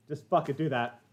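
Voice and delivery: normal talking voice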